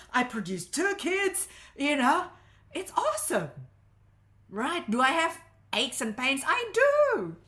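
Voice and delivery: loud, high-pitched proud declaration; emphatic self-affirmation. Shown as a demonstration. Coach modelling love for her own body, exuberant and loud. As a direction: proclaim it exuberantly, voice leaping up.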